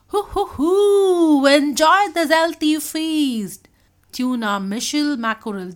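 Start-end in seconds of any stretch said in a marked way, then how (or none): 0.1-3.7 loud, high, drawn-out hooting cheer; whoop, then present the feast grandly and slowly; gleeful whooping. A narrator's reading. Dolphin offering friends a plant feast, gleeful whooping. Doobie proudly presents the treat.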